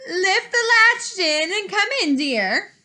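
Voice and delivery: put-on the wolf putting on a sweet old lady voice; wolf in Grandmother's voice. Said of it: "called the wolf in Grandmother's voice".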